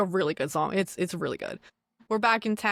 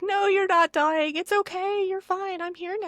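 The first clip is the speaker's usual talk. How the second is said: much higher, desperate reassuring voice; pleading Belle; 11 semitones higher than usual. Retold or imitated. Belle begs the dying beast to stay, high and pleading. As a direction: plead with him not to go, pitched way up.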